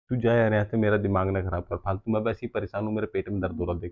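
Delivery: low, subdued grumbling; put-on sufferer's low moan; weary complaint. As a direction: complain softly, pitched well down. Husband complains of stomach pain.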